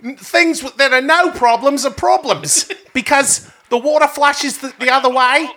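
high-pitched, hammed-up Aussie accent; mock Australian accent. Host explaining Down Under problems, mock Australian accent. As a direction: put on a silly high Australian voice. Mocking Australian caller's country.

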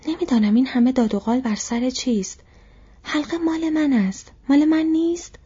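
high-pitched, defensive outburst; worked-up, defensive plea; agitated protest. Acted. Cornered Bilbo protests his innocence.

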